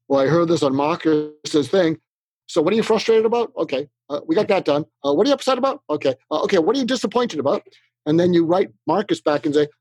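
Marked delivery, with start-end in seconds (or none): 0.1-6.4 checking boxes. Retold or imitated. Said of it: "like you're checking boxes". 6.4-7.6 "disappointed" rushed out fast and higher, box-ticking; checking boxes. Shown as a demonstration. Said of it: "like you're checking boxes".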